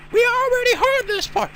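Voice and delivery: high, slow whining complaint; high whiny voice. Acted. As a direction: whine like an annoyed girl.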